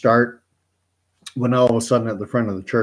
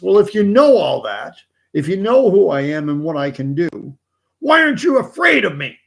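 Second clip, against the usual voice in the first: high, loud, strange demon voice rising; exasperated devil; 7 semitones higher, 6 dB louder than usual. Acted. Satan demands to know why the old man isn't afraid, building.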